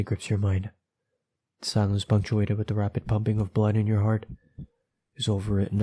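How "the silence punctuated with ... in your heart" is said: plain reading voice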